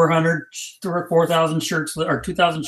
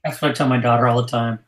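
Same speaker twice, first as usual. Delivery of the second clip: usual speaking voice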